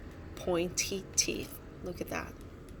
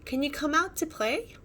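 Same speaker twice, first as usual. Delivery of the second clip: calling out; 7 semitones higher than usual. A narrator's reading. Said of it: "cried Cody".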